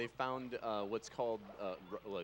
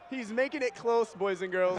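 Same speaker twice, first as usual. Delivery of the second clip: esports caster; 8 semitones higher, 7 dB louder than usual. Real, not acted. Hyped play-by-play call.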